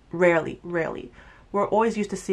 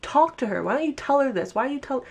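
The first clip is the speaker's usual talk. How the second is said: mock urging friend; 7 semitones higher than usual. Recapping a show, imitating Kelly urging her friend to talk, higher and pushier. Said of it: "And Kelly's like".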